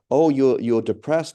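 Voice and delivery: scornful wife. Retold or imitated. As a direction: brush off his complaint loudly. Wife voiced mocking the husband.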